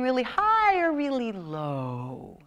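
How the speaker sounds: slow demo of high and low voice. Shown as a demonstration. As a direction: swoop the pitch high then low. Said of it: "vocal variety is pitch".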